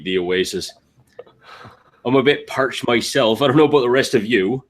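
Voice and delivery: ordinary talk, nothing marked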